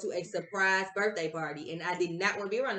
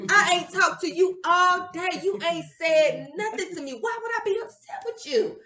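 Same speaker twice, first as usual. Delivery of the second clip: exasperated retort; 7 semitones higher, 4 dB louder than usual. Retold or imitated. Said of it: "I said".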